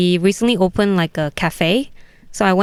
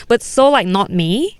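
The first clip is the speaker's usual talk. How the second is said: ordinary talk, nothing marked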